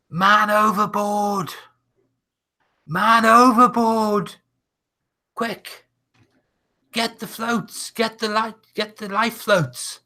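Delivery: high, loud alarm cry from the deck; sailor shouting. Acted. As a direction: yell it out as a panicked sailor. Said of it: "there's a shout goes out".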